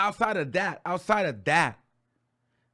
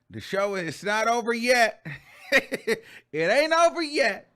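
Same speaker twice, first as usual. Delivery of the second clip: excited comeback; 4 semitones higher, 4 dB louder than usual. Retold or imitated. Acting Wendy popping back up.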